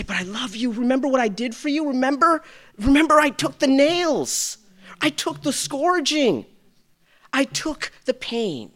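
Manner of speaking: high, urgent pleading voice rising to loud; Jesus pleading. Acted. Jesus reminding the sinner of his sacrifice, high pleading. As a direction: cry out to the listener like Christ remembering the cross. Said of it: "he wants this story to jump up and say".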